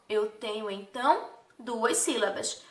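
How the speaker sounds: ordinary talk, nothing marked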